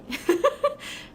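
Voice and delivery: a high, rising burst of laughter; real laugh. Real, not acted. Laughs recalling the surprise.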